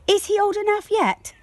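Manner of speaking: put-on high, quick child's voice asking; a small girl begging to be grown up; eager little girl. Josie asks her parents, high eager child voice.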